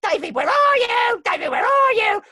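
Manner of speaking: very high, loud ghostly shout; Peeves the Ghost. Acted. Sneaking up behind a child at a party, ghost shout. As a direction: creep up and scare the boy. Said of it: "went up behind him and scare him".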